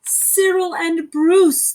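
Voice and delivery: usual speaking voice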